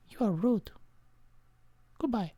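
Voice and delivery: high, soft huffy exit; mock hurt farewell; high offended. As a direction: leave offended. Offended stranger leaves.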